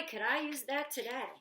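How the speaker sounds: usual speaking voice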